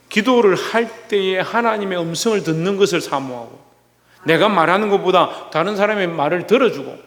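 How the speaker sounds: ordinary delivery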